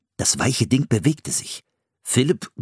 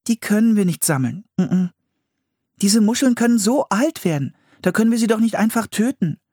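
put-on high young girl, faster at the end; girl voice, protesting; 13 semitones higher than usual. A narrator's reading. Anne refuses to collect old clams, high and upset. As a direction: she can't bear to kill them.

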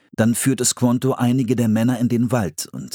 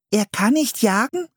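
surprised. Acted. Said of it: "fragte das kleine Mädchen überrascht".

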